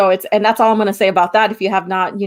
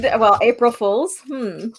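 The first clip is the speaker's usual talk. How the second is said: ordinary delivery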